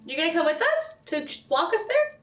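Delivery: ordinary delivery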